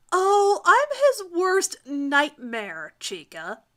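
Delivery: very slow, loud, cocky drawl; grinning boast. Acted. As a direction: savour every word with a grin. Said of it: "grinning at her".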